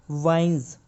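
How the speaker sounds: वाइन्स ending in heavy z; वाइन्स with z, not s; heavy z sound. Shown as a demonstration. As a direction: close वाइन्स on a voiced z. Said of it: "heavy sound आएगी".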